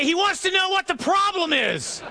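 high, sustained yell over the wind; shouted relay. Acted. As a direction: pass the message on at a shout. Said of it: "And I say".

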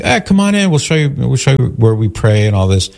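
high-pitched, imitated friendly invitation; friendly welcome. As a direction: usher a visitor in, imitating a cheerful host. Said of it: "he was very friendly".

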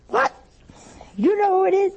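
high-pitched mock astonishment; astonished wife. Wife on the phone learning the nun knows who, astonished. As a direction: shoot the question up high in disbelief.

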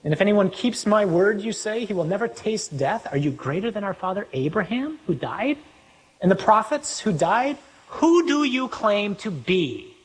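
high-pitched, incredulous questioning; indignant challenge. Acted.

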